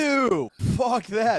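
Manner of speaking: high, loud, drawn-out scoff; hammed-up revulsion, stretched slow; mock disgust. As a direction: recoil in comic outrage. Mock disgust at principled comedian.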